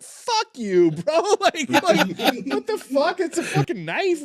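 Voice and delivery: high, laughing retold outburst at the cop; laughing mock outrage. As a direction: laugh through a mock tirade.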